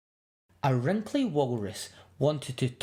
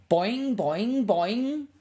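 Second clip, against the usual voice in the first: high, springy boings; bouncy boing; 8 semitones higher, 3 dB louder, much slower than usual. Acted. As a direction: bounce each boing upward. Whale bounces on friend.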